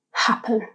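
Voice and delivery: "happen" with the weight on "ha"; "happen", first syllable strong, second light; stress on first syllable. Shown as a demonstration. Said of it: "the stress is on the first syllable".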